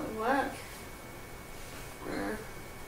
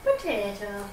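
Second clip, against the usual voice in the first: Irish accent. Shown as a demonstration. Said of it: "say potato in an Irish accent".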